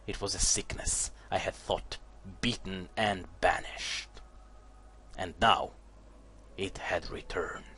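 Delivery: low, soft dramatic narration, fading to a whisper; hushed storyteller voice; story narration. A narrator's reading. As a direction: read the lore like a dark tale. Reads the game's story text.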